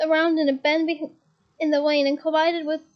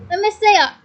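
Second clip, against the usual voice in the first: loud, higher demand; shouted; 5 semitones higher, 9 dB louder than usual. A narrator's reading. Hagrid pushing in to see Katie, loud shout. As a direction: insist loudly on seeing her. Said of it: "shouted Hagrid".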